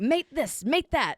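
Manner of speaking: high-pitched mock Aussie "mate this, mate that"; mock Australian. Teasing her Aussie friend, a playful high Australian accent. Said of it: "you're always like".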